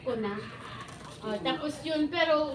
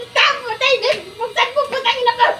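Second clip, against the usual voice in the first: screaming much higher and louder, half laughing; furious yelling; 11 semitones higher, 10 dB louder than usual. Retold or imitated. Wife yelling at her husband to open the door, laughing shout. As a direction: yell and curse at the door with a laugh breaking in.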